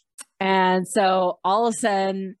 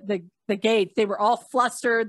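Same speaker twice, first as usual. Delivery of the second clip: ordinary talk, nothing marked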